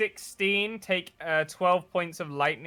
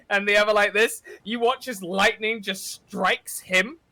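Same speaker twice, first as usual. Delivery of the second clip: usual speaking voice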